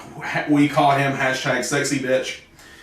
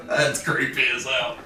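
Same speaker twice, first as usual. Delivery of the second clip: softer, laughing repeat; 3 dB quieter than usual. Real, not acted. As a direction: chuckle it out. Laughs repeating his remark.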